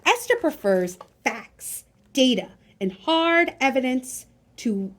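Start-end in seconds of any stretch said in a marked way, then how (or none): none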